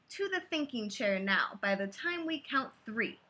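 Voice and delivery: rhythmic read-aloud of a rhyming book; lilting bedtime-story voice; story narration. A narrator's reading. Reading a picture book aloud.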